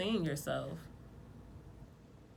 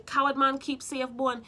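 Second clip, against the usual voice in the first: Jamaican proverb; 6 semitones higher, 4 dB louder than usual. Retold or imitated. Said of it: "That's the way the saying is in Jamaica".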